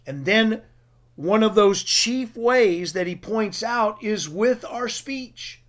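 ordinary talk

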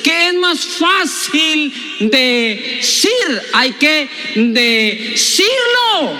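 very slow, words pulled apart; drawn-out emphasis. Real, not acted. Preacher driving home "hay que decirlo", extremely drawn-out delivery. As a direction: stretch every word, breaking "decir" into pieces. Same question stretched out for emphasis.

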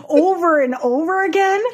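ordinary talk, nothing marked

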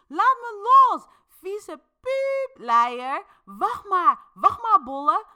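high-pitched, full-force furious scream. A narrator's reading. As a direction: scream threats while being held down. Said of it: "Dis schreeuwt hierdoor alleen maar nog harder".

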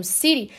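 "city" with T tapped like a light R; American "city", tongue flick instead of T; American flapped T. Shown as a demonstration. Teacher repeating the American version, flapped T. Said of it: "que eu falo no inglês americano".

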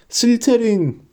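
high-pitched calling out; the hat's high verdict cry; shouted announcement. A narrator's reading. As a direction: proclaim the house name to the hall. Hat calls out the house name.

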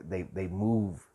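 ordinary delivery

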